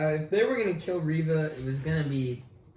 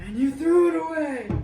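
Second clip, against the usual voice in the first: high, loud accusing shout; hammed-up angry movie quote; explosive villain outburst; 11 semitones higher, 7 dB louder than usual. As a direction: burst out in theatrical fury.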